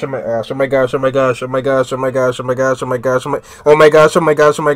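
low, soft run-on repetition; stunned babble. Real, not acted. Viewer stunned by the crash, low babbling. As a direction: loop the words under your breath without stopping. Panicked repeating at the screen.

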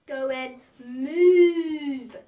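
slow, loud drawn-out cow voice; dad joke punchline. Acted. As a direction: stretch it out like a mooing cow. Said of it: "That sounded like a dad joke".

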